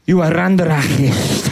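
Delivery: hammed-up Spanish policeman; Spanish cop voice. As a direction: play a Spanish-accented cop making an arrest. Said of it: "the Spanish version of that thing".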